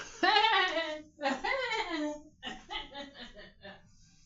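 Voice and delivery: real loud laugh; loud high laugh. Real, not acted. Laughs off tears on camera.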